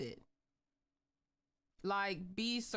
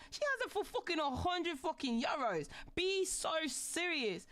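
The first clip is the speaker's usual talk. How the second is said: ranting; 6 semitones higher than usual. Real, not acted. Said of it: "I'm ranting again".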